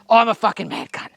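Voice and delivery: punchy boast to get ready; self-hype. Real, not acted. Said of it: "and I told you I am".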